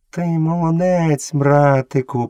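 high, slow creature voice; voice from the thickets. A narrator's reading. Unseen friend praising the boy from thickets, drawn-out voice. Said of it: "повторив голос у хащах".

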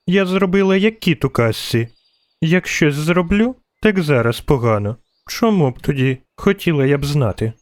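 put-on childish voice, starting high; little girl complaining. A narrator's reading. Girl in the tree answers her scolding, childish complaining voice. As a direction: grumble like a small girl. Narrator voices young Ciri.

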